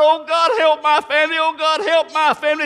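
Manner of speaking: loud, high preached plea; desperate calling out. Acted. Family calling to God from highway wreck, loud desperate plea. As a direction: cry out to God at full force. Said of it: "and they call out to God".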